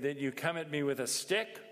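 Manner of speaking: normal talking voice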